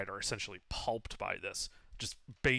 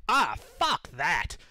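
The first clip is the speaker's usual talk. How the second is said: higher, louder, drawn-out refusal; slow, raised-voice acted defiance; kobold's defiant retort; 5 semitones higher, 7 dB louder, much slower than usual. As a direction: reject it loudly as a defiant kobold. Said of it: "you hear him just respond".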